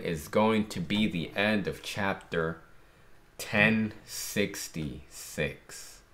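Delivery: soft, even reading of the panels; reading manga aloud. Acted. Fan reading the chapter to viewers, soft reading voice. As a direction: read the lines through, softly.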